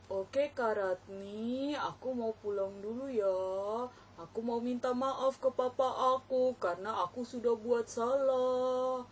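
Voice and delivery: put-on child puppet voice. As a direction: answer the teacher like a shy small boy. Puppet Bogan answers teacher.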